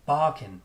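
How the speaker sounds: "Barking" with loud "Bar", weak "king"; emphatic first syllable on "Barking"; strong-weak stress. Shown as a demonstration.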